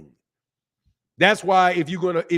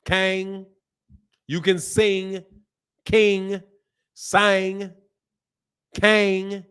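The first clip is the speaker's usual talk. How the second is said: higher-pitched Southern twang on each word; twangy, separated Southern demo words; Southern accent; 4 semitones higher, much slower than usual. Shown as a demonstration. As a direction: demonstrate the Southern "ang" vowel. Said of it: "That's Southern".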